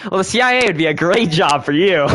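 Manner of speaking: mock CIA ad announcer closing the pitch, hammed-up. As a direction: sell it like a cheesy recruitment commercial.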